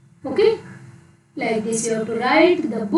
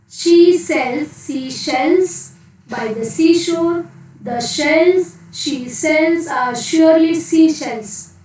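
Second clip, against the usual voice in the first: slow, raised, each word spelled out; slow careful tongue twister; 3 semitones higher, slower than usual. Shown as a demonstration. Teacher models a tongue twister for children, slow and careful. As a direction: lay out every sh sound slowly for the class. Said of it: "Next, one more".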